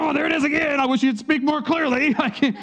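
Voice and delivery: high, loud, exasperated complaint; frustrated self. Retold or imitated. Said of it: "I can either be frustrated".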